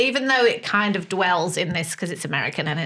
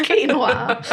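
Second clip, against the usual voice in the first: higher voice breaking into laughs; laughing; 6 semitones higher than usual. Real, not acted. Bantering with friends about Gen X predating quinoa, laughing. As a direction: laugh through the words.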